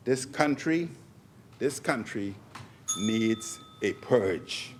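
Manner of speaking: slow, soft, deliberate warning; said slowly. Real, not acted. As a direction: spell out "this country needs a purge" word by word, slowly and quietly. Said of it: "let me say it slowly".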